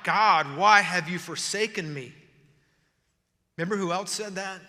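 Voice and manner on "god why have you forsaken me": loud, anguished cry to God. Acted. Fearful psalmist calls to God, crying out. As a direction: cry it out at full, drawn-out force. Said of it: "He's crying out".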